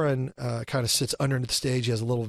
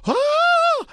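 very high, loud staged shriek; fake scream; 7 dB louder than usual. Actor reacting to an off-screen sight, loud high scream. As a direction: scream as if seeing something awful. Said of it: "And I scream like it's there".

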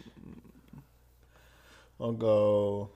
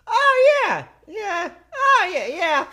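squeaky high voice, louder; silly high voice; 12 dB louder, much slower than usual. Pretend. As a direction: put on a goofy high-pitched voice. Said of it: "What the fuck is that voice?".

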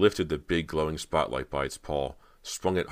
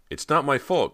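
high, loud defensive cry; 11 semitones higher, 8 dB louder than usual. A narrator's reading. Cody denies blame for the stabbing, high loud cry. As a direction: cry out in panicked self-defence. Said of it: "Cody cried".

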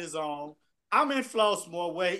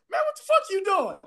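much higher mock alarm; lightly voiced startled Dwayne; imitating Dwayne; 11 semitones higher than usual. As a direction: yelp it like he's startled. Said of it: "Dwayne, like".

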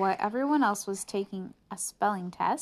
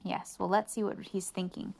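usual speaking voice

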